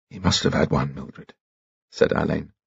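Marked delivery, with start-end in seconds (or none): 0.1-1.3 soft grave. Acted.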